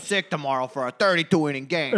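hammed-up die-hard fan voice; diehard Boston fan. Host voicing Boston fans cheering late, mock-defiant fan. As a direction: play a stubborn Boston fan swearing devotion. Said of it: "those guys are like".

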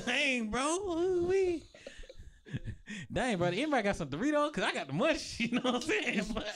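high, giggly stoned voice; stoner voice. Pretend. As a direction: act baked and giddy.

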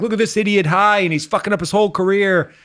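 high-pitched mocking complaint; imitated scolding.

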